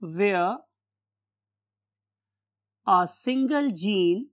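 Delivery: word-by-word dictation with long pauses; slow dictation. Real, not acted. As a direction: dictate slowly as if writing it on the board. Dictates a definition while writing.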